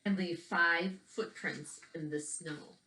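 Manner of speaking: put-on gentle storytelling read for young listeners; picture book narration. A narrator's reading. Teacher reading a picture book to children, storytelling voice. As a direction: read the book aloud to the kids, evenly paced.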